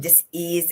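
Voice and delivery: "dis-ease" drawn out as two words; slow "dis", then a distinct "ease"; split-word pronunciation. Shown as a demonstration. Coach listing where it shows in the body, split-word pronunciation.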